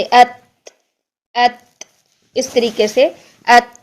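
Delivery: अत with the sound stopped and breath let out; breathy अत. Shown as a demonstration. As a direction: release breath after अत. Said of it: "सांस को जारी करेंगे". (अत में सांस जारी)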